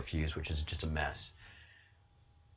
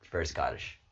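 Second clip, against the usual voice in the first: normal talking voice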